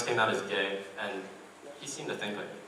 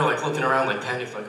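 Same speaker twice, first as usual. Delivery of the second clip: ordinary delivery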